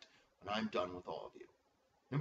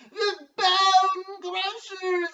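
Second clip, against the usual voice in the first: very high, slow, loud bellow; intense announcer call; 13 dB louder, much slower than usual. Shown as a demonstration. Commissioner showing how the team name is said, bellowing. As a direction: hype it like a monster truck rally. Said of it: "that's how we say his name".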